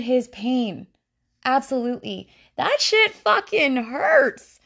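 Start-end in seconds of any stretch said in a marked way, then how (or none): 2.6-4.6 high, loud, drawn-out cry of hurt; stretched, high-pitched emphatic complaint; pained outburst. Real, not acted. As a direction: wail it out slowly and loudly. Venting about being dropped by friend.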